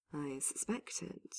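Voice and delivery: soft, slow correction; hushed, measured reply; correcting. A narrator's reading. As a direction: correct them quietly, without haste. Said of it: "Solus corrected".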